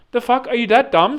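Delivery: high, incredulous outburst; exasperated disbelief. Acted. Customer baffled by drive-thru lady, exasperated disbelief. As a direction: let the indignation jump up in pitch.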